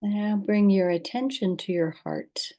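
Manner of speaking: calm, unhurried guidance with long pauses; guided meditation. Acted. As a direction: lead listeners gently into rest, leaving space between instructions.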